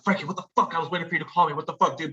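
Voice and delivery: mock panicked rapid-fire phone plea; freaking out. Caller begging Frankie for help with a body, mock panic. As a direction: act frantic, words tumbling out. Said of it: "I'm fucking freaking out right now".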